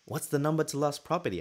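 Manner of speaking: retold annoyed request. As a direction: ask with a touch of irritation. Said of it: "at that point I was just annoyed".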